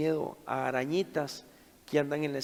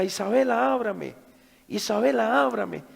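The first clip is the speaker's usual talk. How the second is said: louder, higher shouts through a door; urgent calling; 7 semitones higher, 7 dB louder than usual. Retold or imitated. Father calls through locked door to frightened daughter, loud and urgent.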